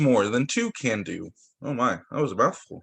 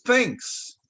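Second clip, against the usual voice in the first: high, strange little bear voice; bear says thanks; 9 semitones higher than usual. Acted. Bear thanking mouse for the pie, high and odd. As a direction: squeeze out a shy thanks.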